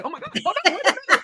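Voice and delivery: overwhelmed customer. Retold or imitated. Overwhelmed young customer in retold scene.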